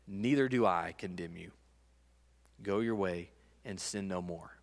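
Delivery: Jesus tenderly releasing the woman; tender forgiveness. Acted. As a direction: let the forgiveness land softly.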